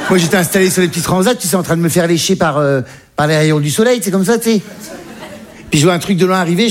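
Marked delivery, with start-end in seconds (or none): none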